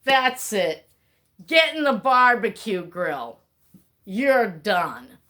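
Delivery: loud, cold brush-off; mock-furious verdict on a failing child; harsh dismissal. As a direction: snap it like you're throwing them away. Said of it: "would you say".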